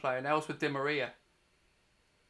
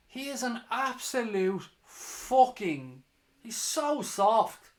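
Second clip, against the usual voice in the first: disgusted drawn-out scorn; 5 semitones higher, much slower than usual. Real, not acted. Disgusted rant at diving player.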